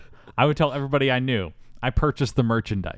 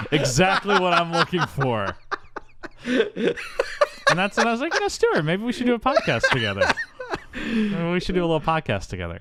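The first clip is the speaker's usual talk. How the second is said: laughing sly pitch; 9 semitones higher than usual. Retold or imitated. Slyly recruiting Stuart, laughing.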